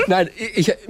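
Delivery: usual speaking voice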